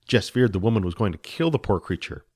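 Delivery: usual speaking voice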